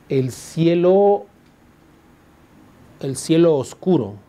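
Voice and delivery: "cielo oscuro" with the final o of "cielo" gliding into "oscuro"; vowels fused. Shown as a demonstration. Teacher showing students a sinalefa, slow and drawn out. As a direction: draw out "El cielo", then join "cielo oscuro" into one syllable. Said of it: "Por ejemplo, si digo".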